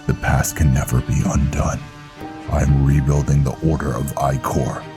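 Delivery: low, projected knight's voice; stern, dishonored knight Velen. Acted. Velen refusing to speak of lost honor, stern.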